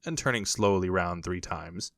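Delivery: ordinary delivery, nothing marked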